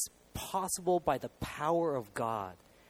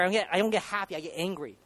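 ordinary delivery

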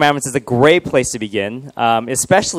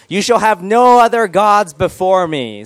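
slow, higher-pitched solemn proclamation; grand proclamation; 6 semitones higher, 4 dB louder, slower than usual. Shown as a demonstration. Pastor reads the first commandment to the room, grand proclamation.

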